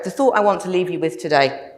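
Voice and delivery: ordinary delivery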